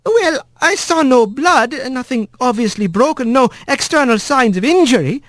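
high polite nurse wren; eager little bird witness. A narrator's reading.

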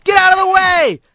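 high yell while fleeing; loud, high holler on the run; urgent shout. A narrator's reading. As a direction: shout to clear a path. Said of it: "taking off for the river again".

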